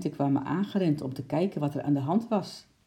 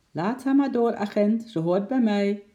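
louder, higher call upward; 6 semitones higher, 5 dB louder than usual. Acted. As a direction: call up the stairs to the policeman. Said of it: "riep oom Nijltje naar boven".

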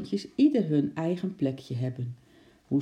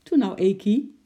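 higher, louder, mildly impatient coaxing; impatient call; 5 semitones higher, 6 dB louder than usual. A narrator's reading. Lotje coaxing her dawdling pet, impatient call. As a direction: urge the pet on with some impatience. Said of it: "riep Lotje ongeduldig".

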